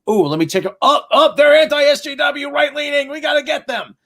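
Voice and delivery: high, shouting mock outrage. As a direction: squeal it like a triggered Twitter user. Mocking Twitter users.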